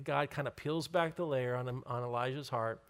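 ordinary delivery

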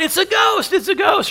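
high frightened shout; terrified cry. Acted. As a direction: scream it like you saw a ghost. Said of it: "and they cried out".

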